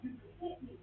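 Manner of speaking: imitating the wind knocked out of her; retold breathlessness. Woman recalling being punched by her husband, breathless retelling. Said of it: "losing all my breath".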